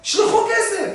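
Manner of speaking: high, pleading son's cry; son's voice pitched well up, pleading; desperate son. Retold or imitated. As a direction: play the broke son begging mom. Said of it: "התקשר לאמא".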